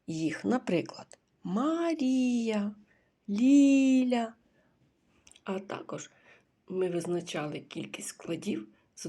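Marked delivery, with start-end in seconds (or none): 1.4-4.3 calling out names. Shown as a demonstration. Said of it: "гукаючи їх".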